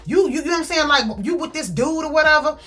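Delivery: high-pitched, riled retold challenge; upset confrontation. Jealous man confronting his ex on the block, upset. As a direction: square up to her, pitch jumping.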